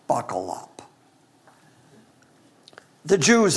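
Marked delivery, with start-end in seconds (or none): 0.1-0.8 dropped low and soft, a wry throwaway; low joking aside. Real, not acted. Preacher warning the congregation, low and wry. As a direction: murmur it like a private joke.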